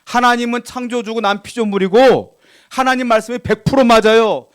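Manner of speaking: ordinary delivery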